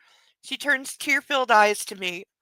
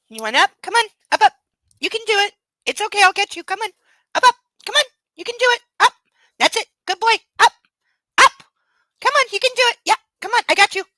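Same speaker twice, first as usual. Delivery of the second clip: coaxing the puppy; 10 semitones higher, 7 dB louder, much faster than usual. Real, not acted. Owner cheering her puppy up onto her lap, high and loud. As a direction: cheer the puppy up onto the seat, much higher.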